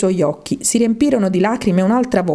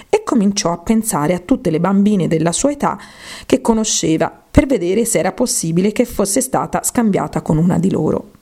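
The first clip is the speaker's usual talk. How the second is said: plain reading voice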